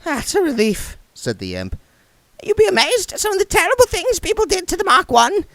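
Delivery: chirpy little creature voice; tiny imp. A narrator's reading. The imp chats to William, very high squeaky.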